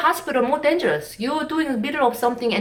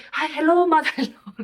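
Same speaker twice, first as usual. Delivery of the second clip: mock polite greeting; 7 semitones higher than usual. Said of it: "That means".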